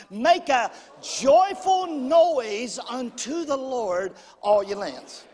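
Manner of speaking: slow, emphatic verse reading; led scripture recitation. Acted. Preacher leading the congregation in Psalms, slow emphatic recitation. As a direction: proclaim each word for a crowd to follow.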